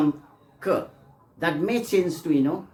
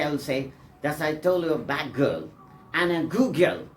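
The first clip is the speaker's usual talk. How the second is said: ordinary talk, nothing marked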